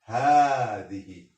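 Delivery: هذه with the first "haa" held long and loud; pronunciation demo. Shown as a demonstration. Teacher shows students how it is pronounced, هذه with a long "haa". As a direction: stretch the opening vowel of هذه, slowly and loudly. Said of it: "mais se prononce".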